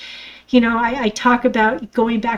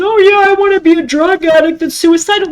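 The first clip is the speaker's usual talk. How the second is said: mocking quote; 7 semitones higher, 6 dB louder than usual. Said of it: "nobody wakes up and says".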